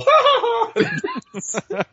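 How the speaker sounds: very high imitated bird laugh; mock creature cackle. Player imagines birds lifting them up, high mock cackle. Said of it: "and then go".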